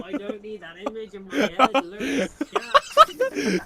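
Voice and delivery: high, loud talk breaking into laughter; real laughter through the words; laughing. Real, not acted. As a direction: crack up while protesting. Cracking up at the image.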